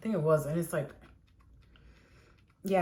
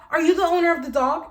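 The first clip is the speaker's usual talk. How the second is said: higher and louder, insistent repeat; demanding lady; 8 semitones higher, 5 dB louder, faster than usual. Retold or imitated. Angry neighbor lady repeating to Jose, loud insistent demand. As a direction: press the question again, entitled. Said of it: "Look how she's talking".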